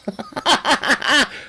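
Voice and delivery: high laugh in quick bursts; real laugh. Real, not acted. Streamer attempting a Scot's line, breaking into high laughter. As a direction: break up laughing mid-story. Breaks up during acted Scot quote.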